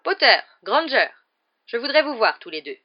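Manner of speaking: calling out. Acted. McGonagall calling two students across the hall, loudly. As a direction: call them over the crowd. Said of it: "qu'une voix appela".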